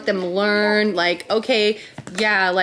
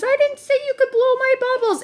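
mock-angry little girl, pitched much higher; mad little girl. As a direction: squeak it out like a cross toddler. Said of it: "the girl gets mad and says".

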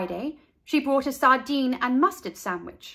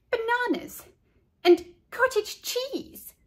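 can't believe it; squealing disbelief; 13 semitones higher than usual. A narrator's reading. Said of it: "asked Leslie".

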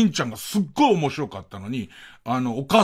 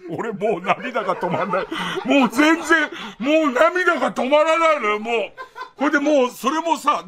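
moved and laughing at once; laughing through tears; 7 semitones higher than usual. Real, not acted. (涙が止まらないほど笑いながら)

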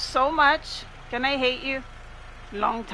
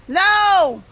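loud high yells of no; desperate no; 7 semitones higher, 7 dB louder than usual. Real, not acted. Gamer yelling as her character is caught. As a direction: shout it out in despair.

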